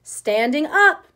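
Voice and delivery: high, loud, slow shout; all-night argument, slow stubborn shout. A narrator's reading. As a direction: keep shouting the demand. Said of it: "argued all through the night".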